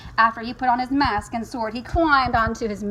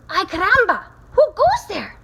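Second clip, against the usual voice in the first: high, loud, dramatic cry; startled challenge; 9 semitones higher, 7 dB louder than usual. Acted. Kitten challenging a mysterious band, startled. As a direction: call out sharply to strangers, high and loud.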